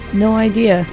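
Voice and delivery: ordinary talk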